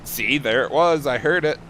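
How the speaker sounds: high, loud "I heard it"; gleeful gotcha; triumphant gotcha. Real, not acted. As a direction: crow over catching the accent. Catching the accent triumphantly.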